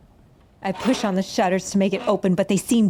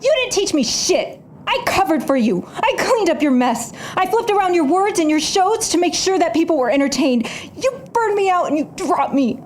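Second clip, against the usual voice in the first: bitter accusation; 6 semitones higher than usual. Acted. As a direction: throw every grievance back at him. Quarrel with former mentor.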